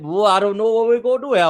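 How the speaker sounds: imitated bayou drawl. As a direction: put on a Cajun cook's accent. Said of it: "why is he talking like that man".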